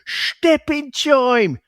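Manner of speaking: very high, drawn-out mangled Cockney, TIME as "choim". Retold or imitated. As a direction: squawk out a hammed-up bad Cockney impersonation. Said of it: "the way he delivers it is just like".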